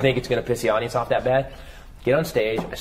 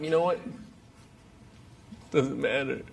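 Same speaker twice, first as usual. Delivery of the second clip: hopeless resignation; 6 semitones higher, 6 dB quieter than usual. Retold or imitated. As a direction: let it go like a man who's given up. Despairing over divorce, suicidal.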